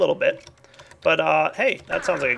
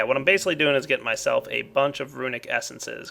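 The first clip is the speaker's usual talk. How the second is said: usual speaking voice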